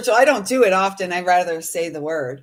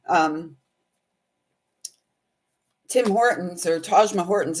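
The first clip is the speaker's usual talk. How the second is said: ordinary talk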